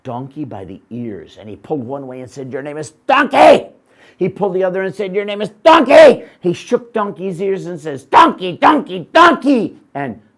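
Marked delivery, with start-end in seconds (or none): 2.5-3.7 a loud, high angry yell; angry shout. Acted. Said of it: "Man became angry". 5.1-6.2 a loud, high angry yell; angry shout. Acted. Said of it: "Man became angry". 8.0-9.8 each Donkey louder and higher; angry shouts building. Acted. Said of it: "Man became angry".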